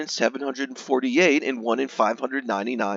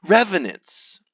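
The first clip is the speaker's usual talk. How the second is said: hammy stage actor; 8 semitones higher, 4 dB louder than usual. Acted. Dramatic reveal of the source.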